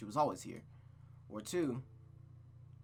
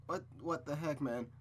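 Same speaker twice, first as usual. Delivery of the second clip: ordinary talk, nothing marked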